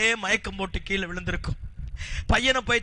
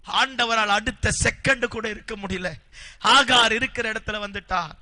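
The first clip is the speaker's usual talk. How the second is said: ordinary talk, nothing marked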